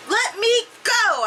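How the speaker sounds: high, drawn-out yell at normal volume; story yell. A narrator's reading. Girl to the people grabbing her arms, yelling. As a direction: cry it out high and slow. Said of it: "I yelled".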